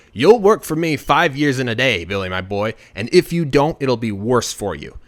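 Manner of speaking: loud, threatening command; booming threat with punched phrases; angry leprechaun. Acted. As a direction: lay down the law, loudly. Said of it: "the leprechaun gets angry".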